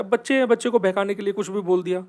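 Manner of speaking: ordinary delivery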